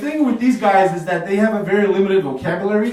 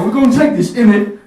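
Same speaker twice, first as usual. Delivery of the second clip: British colonizer; 6 semitones higher, 9 dB louder than usual. Retold or imitated. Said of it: "They went to Africa".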